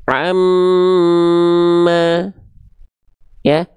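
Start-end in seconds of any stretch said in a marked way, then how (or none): 0.1-2.4 AMMA with a long, steady hum on the M; held nasal M. Shown as a demonstration. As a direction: hold the m in "amma" on a higher note, then open to a. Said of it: "itu kita tahan dua harokat". ("amma", mim ditahan dua harakat)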